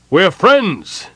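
high, loud call across a distance. A narrator's reading. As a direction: call out to unseen archers. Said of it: "he called out in Elven".

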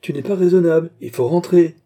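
plus haut et plus fort, sans réplique; ordre ferme. Acted. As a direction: ramener le mort à sa tombe, plus fort. Grimbaud ordonne de rentrer. (le gardien ordonne au mort de rentrer, haut et fort)

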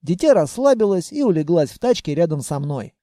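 ordinary delivery, nothing marked

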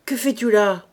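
loud, gruff challenge; brusque interrogation. Acted. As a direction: question the child roughly. Gruff man interrogates child at door.

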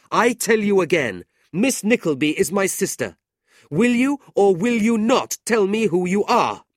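very high, loud, mounting outrage; furious demand. A narrator's reading. Brother demanding insulter's name in street, furious. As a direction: insist with full force.

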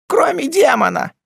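high, urgent woman's voice; alarmed wife chiming in. A narrator's reading. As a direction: jump in fearfully, pitched very high. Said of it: "подхватила Маас".

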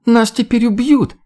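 high, panicked shriek; hysterical woman. A narrator's reading. Woman on the bunk fears being killed, hysterical. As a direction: wail it in rising hysteria. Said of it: "истеричный женский голос".

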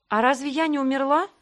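genuinely puzzled, voice lifting; удивление. A narrator's reading. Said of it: "удивилась впервые за вечер". (удивлённо, повышая голос)

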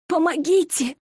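strained raspy plea; hoarse cry for help. Acted. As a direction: croak out a desperate cry. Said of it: "смогла прохрипеть Эри". (хриплый крик о помощи)

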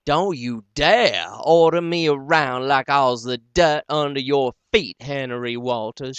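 loud, indignant retort; temper flaring. Acted. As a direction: bite back at him hard. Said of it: "her temper riz up too".

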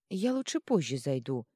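plain reading voice

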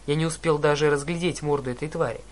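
plain reading voice

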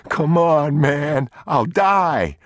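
high, loud plea for life; desperate pleading. Acted. Thief about to be left naked begs, desperate. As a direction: beg at a high pitch.

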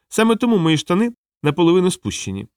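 high-pitched small clown voice; small, high-voiced clown; little clown. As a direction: explain earnestly in a high voice.

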